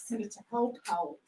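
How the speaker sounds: soft conspiratorial aside; gleeful whisper-aside. Acted. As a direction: tell yourself the secret. Private scheming aside.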